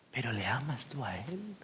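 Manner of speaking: unvoiced intimate whisper. Real, not acted. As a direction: lean in and breathe the question. Preacher asks pointed question. (pregunta susurrada)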